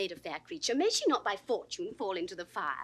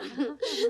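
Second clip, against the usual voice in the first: light laugh; 2 semitones higher than usual. Acted. Laughing at her own oath.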